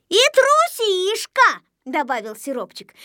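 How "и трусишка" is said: high, slow, stretched jeer; taunting. Acted. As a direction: pile on with a drawn-out high taunt. Taunt piled on in quarrel. (дразня, высоко и протяжно)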